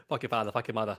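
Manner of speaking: low, soft echo in mock Boston accent; understated New York-Boston imitation; New Yorky Boston accent. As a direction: quietly copy the tough Boston line. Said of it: "like, sort of New Yorky".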